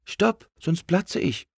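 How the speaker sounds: high, drawn-out happy balloon cry; joyful, high cartoon balloon; joyful call. A narrator's reading. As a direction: call it out gleefully, slowly. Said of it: "rief er freudig".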